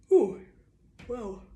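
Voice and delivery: yawning mid-word. Real, not acted. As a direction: talk through a yawn. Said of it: "With that yawn".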